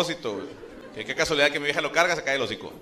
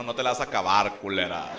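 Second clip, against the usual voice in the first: mock macho threat; 7 semitones lower than usual. Husband acts macho threat.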